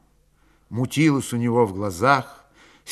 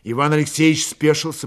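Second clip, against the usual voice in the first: plain reading voice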